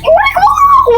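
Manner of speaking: very high-pitched desperate wail; frantic wailing plea. Acted. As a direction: beg in a rising panic, stretching the end. Same plea escalating to wail. (matinis at desperadong pagmamakaawa)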